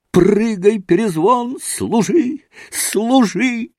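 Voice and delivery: high-pitched commands to a dog; excited yelling. A narrator's reading. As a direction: cry out eagerly, pitched well up. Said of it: "завопил Коля".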